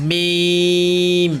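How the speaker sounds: মিম drawn out on a level tone; careful letter demonstration. Shown as a demonstration. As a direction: hold the "ee" of মিম very long. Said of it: "উচ্চারণ আমাদের দরকার".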